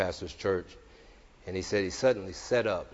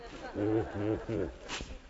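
muffled forced laugh, softer; laugh into pillow; 6 dB quieter than usual. Retold or imitated. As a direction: imitate laughing smothered in a pillow. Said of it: "just did it in his, in his pillow".

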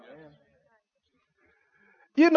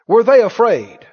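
ordinary delivery

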